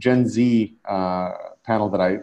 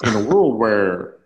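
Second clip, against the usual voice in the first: movie trailer announcer; slower than usual. Shown as a demonstration. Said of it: "can you do that in your announcer voice".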